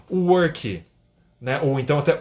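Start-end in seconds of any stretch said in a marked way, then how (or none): 0.1-0.8 English word with a Brazilian Portuguese accent; thick Portuguese ending on "work". Shown as a demonstration. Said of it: "muitas pessoas pronunciando".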